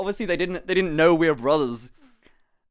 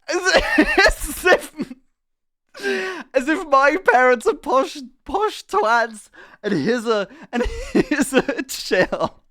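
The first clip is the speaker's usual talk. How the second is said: laughing through the words; slow, high, laughing disbelief; 7 semitones higher, slower than usual. Real, not acted. Laughing retelling insult.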